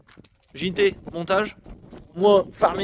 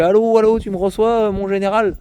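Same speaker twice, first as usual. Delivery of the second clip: louder radio operator call; over walkie talkie; 6 semitones higher, 3 dB louder than usual. Pretend. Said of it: "je déploie l'antenne comme ça". (appel radio au talkie-walkie)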